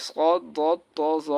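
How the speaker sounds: স্কদ, দদ, ত, জ as heavy Arabic emphatics; full, thick sound on স্কদ, দদ, ত, জ; thick consonants. Shown as a demonstration. Teacher naming the heavy letters, each said thick. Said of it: "এগুলো মোটা হয়".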